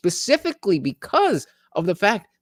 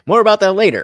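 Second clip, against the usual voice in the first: laughing mock quote; 5 semitones higher, 9 dB louder, faster than usual. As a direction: echo the line with a laugh, louder and faster. Mocking Nixon's sign-off line.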